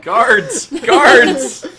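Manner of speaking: loud, high mock alarm call; calling for guards.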